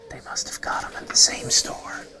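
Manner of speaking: soft whispered aside. Real, not acted. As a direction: share it like a secret.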